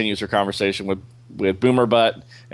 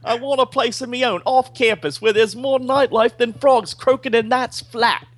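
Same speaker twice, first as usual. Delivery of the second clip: hammed-up Australian accent, much higher; bad Australian accent; 13 semitones higher than usual. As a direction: play a cocky Aussie convict in a clumsy Australian accent. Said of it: "You sound more like Spike from Buffy".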